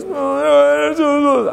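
put-on high, whiny little boy voice; imitating small boy. Father imitating his crying son on the phone, high whine. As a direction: squeak it like a distressed toddler. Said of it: "He goes".

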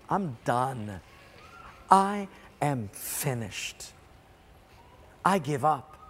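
low, slow and resigned, as if spent; defeated. Acted. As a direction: act out giving up, letting the voice sink. Voicing a soul with nothing left.

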